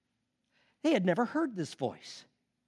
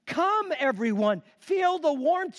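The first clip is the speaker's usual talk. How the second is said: much higher, louder call; eager invitation; 9 semitones higher, 4 dB louder than usual. A narrator's reading. Woman calling the cave people to the fire. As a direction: call everyone over eagerly. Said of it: "she invited".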